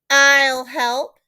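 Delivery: a meddling mother butting in to help, loud; busybody mother. A narrator's reading. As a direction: barge in with a helpful offer. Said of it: "said the busybody mother".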